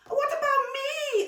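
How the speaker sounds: put-on very high, loud little boy; child asking. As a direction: whine not to be left out. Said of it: "asked Nico".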